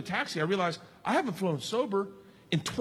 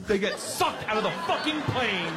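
normal talking voice

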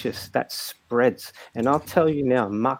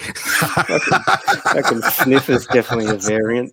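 laughing; 5 dB louder than usual. Real, not acted. Joking about variants, cracking up.

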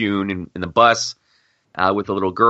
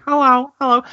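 put-on high, louder kid voice; imitating child; 4 dB louder than usual. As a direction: echo the kid calling out. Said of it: "I keep hearing".

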